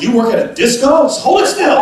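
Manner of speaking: high, loud mock of the bossy wife; wife scolding. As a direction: snap at him to hold the light still. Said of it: "And she's".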